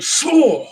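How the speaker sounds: high imitated whoosh of a falling meteorite; playful whistling whoosh. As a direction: make the sound of something streaking down. Meteorite falling, mouth sound.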